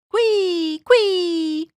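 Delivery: high, loud drawn-out pig squeal; squealing pig cry. A narrator's reading. Lady pig blown through the air, squealing. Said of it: "cried the lady pig".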